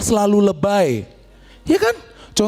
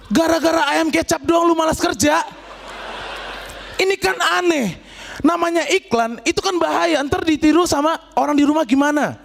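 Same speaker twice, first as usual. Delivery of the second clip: escalating comedy rant; 7 semitones higher than usual. Real, not acted. Comic rant about ads.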